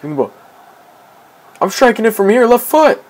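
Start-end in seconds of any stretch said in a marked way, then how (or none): 1.6-3.0 high-pitched, excited play call. Real, not acted. Calling a shot during play.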